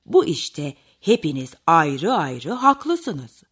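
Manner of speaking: low, slow storybook bear; lightly voiced kindly bear judge; amused Tonton bear. A narrator's reading. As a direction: give a slow, deep, good-humoured verdict. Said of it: "Tonton ayı gülerek".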